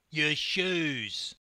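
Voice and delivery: sharp, loud snapped order; curt snapped command. A narrator's reading. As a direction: bite off the words. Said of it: "the Kiwi officer snapped".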